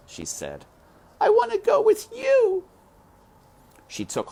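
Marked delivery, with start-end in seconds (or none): 1.2-2.6 put-on very high, louder child's protest; high little girl protesting to her father; pleading little girl. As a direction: beg not to go. Mig protests.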